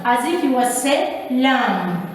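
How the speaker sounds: ordinary delivery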